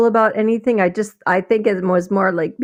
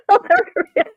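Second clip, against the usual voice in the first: laughing; faster than usual. Real, not acted.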